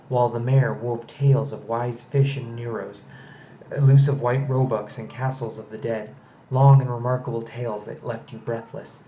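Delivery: usual speaking voice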